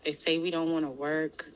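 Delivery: normal talking voice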